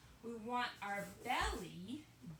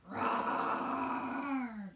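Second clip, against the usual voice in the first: loud mock bear roar; playful roar; 7 dB louder than usual. Shown as a demonstration. Yoga teacher roaring out the breath like a bear, playful roar. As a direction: roar like a friendly bear for the kids. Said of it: "roar it out".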